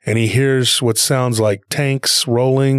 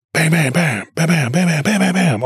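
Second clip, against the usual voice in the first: higher-pitched rattled bursts of bams; quick volleys of "bam", pitched up; imitated gunfire; 4 semitones higher than usual. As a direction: mimic machine-gun bursts with the voice. Imitating gunfire heard down the line.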